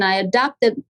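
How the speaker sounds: ordinary talk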